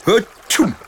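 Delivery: gros éternuement aigu et fort; éternuement de dragon. Acted. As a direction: atchoum de dragon. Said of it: "Le dragon éternua". (éternuement fort et aigu)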